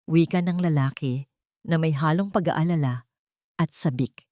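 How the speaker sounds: plain reading voice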